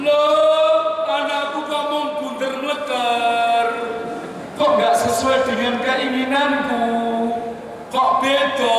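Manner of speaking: high squeaky hen voice, dismayed; shocked mother hen. Acted. Preacher voicing the hen who sees her odd chick, high dismayed squeak.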